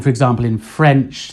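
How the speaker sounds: usual speaking voice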